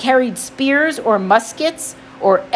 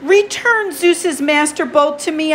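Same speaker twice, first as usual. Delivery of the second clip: higher, urgent plea; pleading boy voice; 6 semitones higher than usual. Acted. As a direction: beg with tense politeness. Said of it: "I said".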